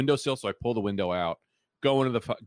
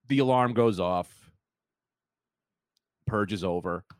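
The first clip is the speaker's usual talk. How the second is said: usual speaking voice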